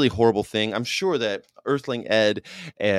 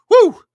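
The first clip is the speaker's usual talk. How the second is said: sudden loud high "woo"; piercing little hoot; high whoop; 16 dB louder than usual. Retold or imitated. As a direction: let out a quick high whoop. Excited exclamation in retold scene.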